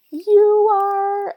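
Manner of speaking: high, loud whiny wail; mock-bawl it like an indignant kid; hammed-up imitation of a child's tantrum; dramatic child. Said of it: "she was being so dramatic".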